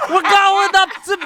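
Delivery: high, loud Cosby-style voice; Bill Cosby impression. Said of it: "that was good".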